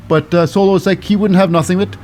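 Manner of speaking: normal talking voice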